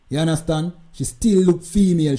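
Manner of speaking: ordinary talk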